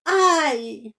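high, loud ouch; yelp of pain. Acted. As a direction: yelp when the stick hits. Carmelito frappé. (Carmelito crie aïe, frappé par le bâton)

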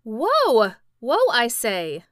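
loud yell, drawn out. A narrator's reading. Boy yelling at the horse from a distance, loud. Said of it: "from where he again yelled".

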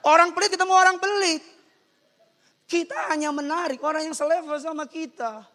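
high, loud preaching, easing slightly; full-force preaching. Acted. Preacher restates the rule to congregation, high loud preaching. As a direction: proclaim it loudly to the congregation.

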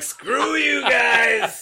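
high, drawn-out hammed-up shout of defiance; mock defiant shout. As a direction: yell it at the fans like a rebel, very slow and loud. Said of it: "And you're like".

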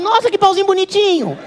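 high, cooing mock admiration; imitated admiring woman. Woman reacting to the surgery result, mock cooing. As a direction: coo it high, as if at something cute.

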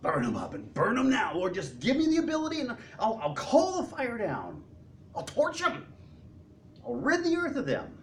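high, eager cry to burn them; a hothead begging for fire; hammed-up zeal. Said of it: "He's saying what?".

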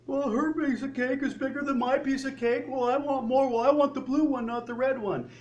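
put-on high, whining child voice; bratty child complaining; whiny kid. Whiny kids bickering over stuff.

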